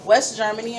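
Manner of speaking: ordinary delivery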